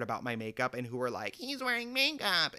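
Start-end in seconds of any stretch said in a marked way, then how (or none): 1.4-2.5 high-pitched mocking complainer; mock whiner. Angry coworkers complaining about his makeup, mock whining. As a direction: mimic an outraged tattletale in a high voice.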